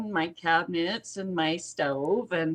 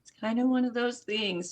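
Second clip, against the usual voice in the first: sheepish shrug; 7 semitones higher than usual. Retold or imitated. Own sheepish reply to son.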